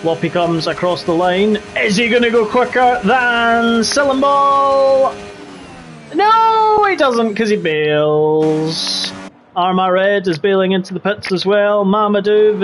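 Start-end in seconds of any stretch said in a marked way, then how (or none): 1.8-5.1 suspenseful race call. Real, not acted. Commentator's drawn-out line-crossing call. 6.1-9.1 deflating race call. Real, not acted. Commentator's call as lap is abandoned.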